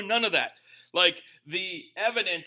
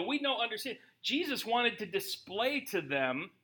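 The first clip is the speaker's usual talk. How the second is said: usual speaking voice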